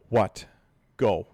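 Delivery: loud, low one-word answers; curt exasperation. Retold or imitated. Parent answering a pestering child, curt and exasperated. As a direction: cut in like an impatient parent.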